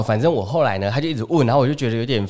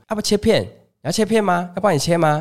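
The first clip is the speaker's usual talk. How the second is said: higher auntie voice, persistently asking; nagging auntie; 7 semitones higher than usual. Retold or imitated. Auntie pestering the customer about slicing, higher. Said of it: "一直不停地问我说".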